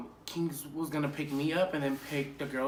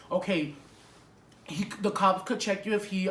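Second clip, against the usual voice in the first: usual speaking voice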